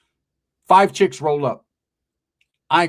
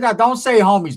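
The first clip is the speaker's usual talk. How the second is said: scolding; 7 semitones higher, 8 dB louder than usual. Retold or imitated. Scolding her for saying homies.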